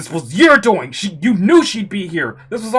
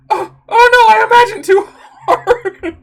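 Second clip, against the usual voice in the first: much higher, loud flustered cry with a laugh; panicked yelp; 13 semitones higher, 11 dB louder than usual. Acted. Nervous girl spotting her friend posing nude, panicked yelp. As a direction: blurt it out in embarrassed panic.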